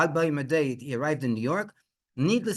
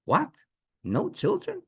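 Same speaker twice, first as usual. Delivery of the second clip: very high, rising question; incredulous disbelief; 14 semitones higher than usual. Acted. Everyone hearing there were no children, incredulous. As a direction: squeak it out in astonishment.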